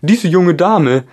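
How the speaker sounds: high, loud, slow gryphon; grand creature announcement. A narrator's reading. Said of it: "sagte der Löwengreif". (Greifenstimme, hoch, laut, langsam)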